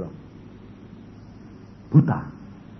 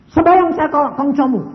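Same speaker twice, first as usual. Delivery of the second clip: high, loud voice of God giving an order; booming divine command; 11 semitones higher, 7 dB louder than usual. Retold or imitated. God tells the man to pray to his ancestor, booming. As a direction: announce the strange command.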